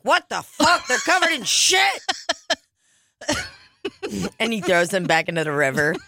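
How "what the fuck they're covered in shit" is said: much higher, mock-disgusted yelp; disgusted stranger. Finder of the glasses recoiling in a high squeal. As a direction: squeal it like a grossed-out guy finding shit on the glasses. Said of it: "He's like".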